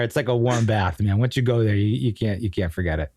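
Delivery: slipping into a low Boston accent. Shown as a demonstration. Native telling friend about the accent, Boston accent. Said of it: "I'm from there".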